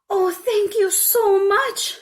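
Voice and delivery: high, loud, slow gush of thanks; a delighted child thanking her; grateful little girl. A narrator's reading. Little girl thanking the old woman, delighted and high. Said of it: "said the little girl".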